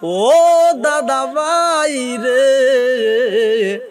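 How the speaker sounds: slow, drawn-out sung wail; weeping child's call. Acted. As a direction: call out to grandfather in a long tearful chant. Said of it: "আমার নবী কান্দে".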